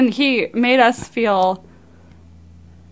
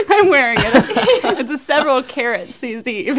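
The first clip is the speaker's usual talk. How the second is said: laughing through the words, pitched higher; 5 semitones higher, 3 dB louder than usual. Real, not acted. Daughter laughing as she holds up the ring. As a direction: giggle while showing off the fake ring.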